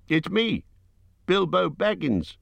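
high, slowed call naming himself; crying out. A narrator's reading. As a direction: call out so the stranger can find him. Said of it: "he cried".

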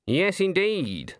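high, slow, drawn-out agreement; poker-faced. A narrator's reading. Granny answers Nanny without giving anything away, poker-faced. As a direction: give a measured, knowing assent. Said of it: "poker-faced".

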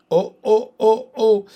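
high, loud anguished wails; two girls finding Aslan gone; crying out in grief. Acted. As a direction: cry out at the empty table. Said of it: "cried the two girls".

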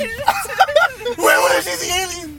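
laughing shriek. Real, not acted. Shrieking joke through laughter.

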